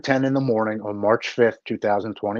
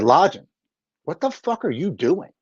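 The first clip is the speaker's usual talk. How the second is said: higher-pitched, emphatic demand in a friend's voice; blunt retold confrontation; 7 semitones higher than usual. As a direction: imitate a friend demanding an explanation. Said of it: "'cause the way he said it".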